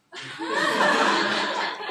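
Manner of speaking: loud laugh. Real, not acted. Class laughing at a joke.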